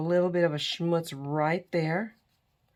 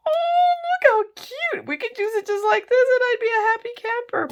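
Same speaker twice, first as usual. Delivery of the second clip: much higher, louder, squeaky delighted voice; squealing gush; 7 dB louder than usual. Pretend. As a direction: gush like a thrilled kid over something adorable. Gushing over the cute umbrella.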